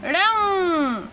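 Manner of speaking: ड़ंग stretched slowly, nasal ng held; slow teaching demo. Shown as a demonstration. Teacher showing students how to say ड़ंग, slow. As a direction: draw out ड़ंग for learners. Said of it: "अंग की मात्रा जुड़ेगी".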